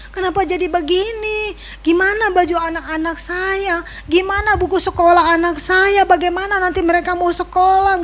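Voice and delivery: high, pleading lament, retelling it; crying out to God. Retold or imitated. Father retelling his tearful cry to God over flooded things. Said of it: "Saya nangis di dalam kamar".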